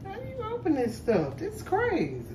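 very high-pitched, exasperated complaint; real exasperation. Real, not acted. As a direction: pitch way up, baffled by a stuck lid. Struggling to open a package.

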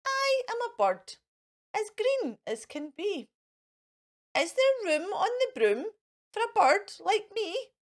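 high, light bird asking. A narrator's reading. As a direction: chirp the request like a bird. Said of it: "then said".

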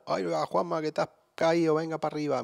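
a hint of a friendly neighbour lifting him; kindly neighbour. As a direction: neighbour finding him fallen in the lobby, mild concern. Imagined neighbour helping him.

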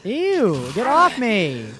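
high, loud panicked yelling; panicked screaming. Real, not acted. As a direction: scream at the attacking zombies. Attacked by zombies, screaming.

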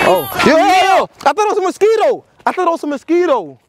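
high startled yell. Real, not acted. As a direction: yell out in a sudden fright. Startled by a mosquito.

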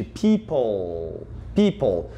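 people said low, the tongue on the front teeth for a clear final L; people ending in L. Shown as a demonstration. Teacher corrects a common error, clear final L. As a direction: end people on a clear L, not a U. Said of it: "O som é de L".

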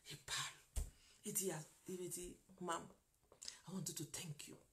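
whispered, awed and grateful; tender whisper. Retold or imitated. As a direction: breathe it softly, overwhelmed. Emotional reconciliation retold.

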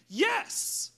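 a petulant high-pitched yes; retort. Acted. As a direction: snap back the yes defiantly. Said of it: "Jonah retorted".

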